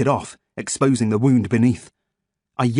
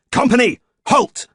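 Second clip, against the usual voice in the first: much higher, loud parade-ground call; command shout; 13 semitones higher, 6 dB louder than usual. A narrator's reading. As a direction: halt the troops with a sharp cry. Commander stops invisible army.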